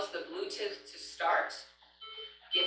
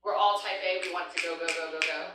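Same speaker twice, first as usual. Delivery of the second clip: normal talking voice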